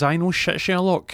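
a mocking know-it-all quip; sarcastic retort. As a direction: toss it back with a sneer.

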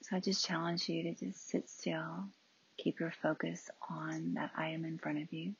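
hushed, spacious meditation guidance; soft meditation leader; guided meditation. Real, not acted. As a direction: gently invite stillness, pausing between phrases. Guides stillness and closing eyes.

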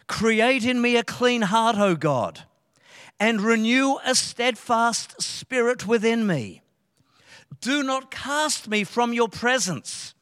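earnest prayerful reading of a psalm; scripture reading. Acted. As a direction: read it as a plea to God. Reads David's psalm aloud.

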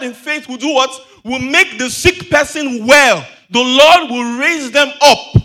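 loud scripture proclamation, slowing to heavy emphasis; full-force preaching. Real, not acted. Preacher reads promise of healing to church, building loud proclamation. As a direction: build to a ringing declaration.

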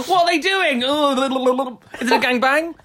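shrieking high with laughter; laughing high squeal. Real, not acted. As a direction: squeal the question while laughing.

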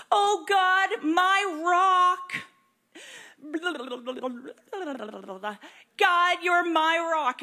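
loud, high cry of faith; fervent cry. Retold or imitated. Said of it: "He's like".

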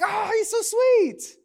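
high, slow cooing imitation; mock sweet wife. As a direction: drag out the sweetness, mockingly. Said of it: "she's like".

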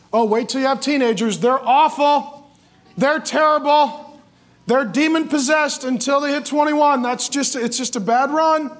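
high, loud, hammed-up warning; exaggerated naysayer, stretching the dire words; mock doomsaying. Other parents warning about teenagers, mock doomsaying.

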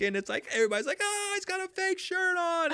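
put-on high, jeering teen voices; mocking schoolkids. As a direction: tease him like a schoolyard crowd. Kids mocking his fake shirt.

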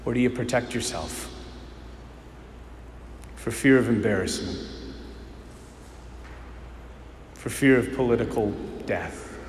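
preacher's quiet final challenge; hushed sermon close. Acted. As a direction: lean in and let each question land quietly.

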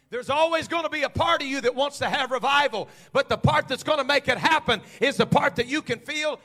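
loud, high, driving sermon cadence; full-force preaching. Acted. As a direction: preach it to the rafters.

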